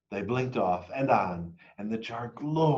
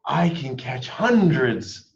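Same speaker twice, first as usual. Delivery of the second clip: louder, slow, joyful shout; 3 semitones higher, 6 dB louder, slower than usual. A narrator's reading. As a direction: shout it with pure joy. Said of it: "and I shouted".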